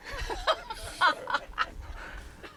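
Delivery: airy little laugh; amused airy laugh; breathy laugh. Real, not acted. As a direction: let out a soft breathy chuckle. Amused at newcomer joining her.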